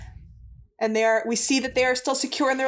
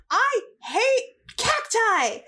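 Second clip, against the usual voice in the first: high-pitched, drawn-out outburst without real volume; hammed-up exasperated confession; mock yell; 9 semitones higher, much slower than usual. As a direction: act out finally exploding, voice pitched way up. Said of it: "And she yells".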